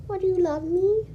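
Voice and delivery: high, bright coo to the purring cat. Real, not acted.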